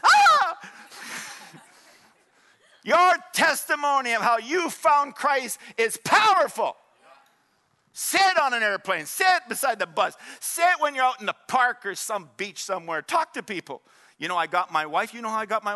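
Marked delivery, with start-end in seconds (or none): 0.0-0.6 very high, loud comic shriek; sudden piercing yelp, played for laughs; mock scream. Acting Paul struck by Jesus' voice. 2.8-13.8 loud, raised exhortation; rousing pulpit delivery, punching the commands; impassioned preaching. Real, not acted. Preaches at full force.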